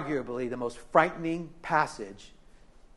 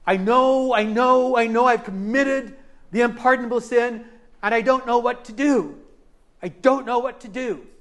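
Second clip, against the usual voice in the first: put-on higher, louder, tearful voice of a frightened young man; mimicking a weeping young man; 6 semitones higher, 3 dB louder than usual. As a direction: play Tommy desperate and close to tears, pitched up. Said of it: "He is shaking, and he's weeping".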